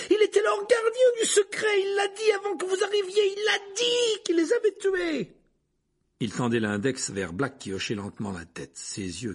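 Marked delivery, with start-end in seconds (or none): 0.1-5.4 high, loud crying yell; tearful shout. A narrator's reading. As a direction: shout it through sobs. Harry shouting, near tears.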